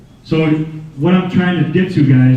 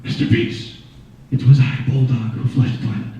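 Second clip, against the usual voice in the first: mysterious cat voice; 4 semitones lower, 4 dB quieter than usual. Pretend. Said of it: "I hear this voice that says".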